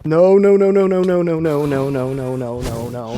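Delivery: rapid-fire panicked refusal, sinking as hope goes; real panic. Real, not acted. Player is dying in the game.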